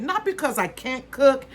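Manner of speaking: ordinary talk, nothing marked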